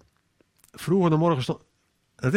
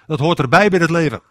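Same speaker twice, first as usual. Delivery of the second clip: usual speaking voice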